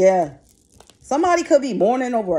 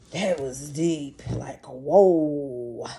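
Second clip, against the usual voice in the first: awed whoa; 6 semitones lower, 4 dB quieter, much slower than usual. Real, not acted. Stunned by a grim reading.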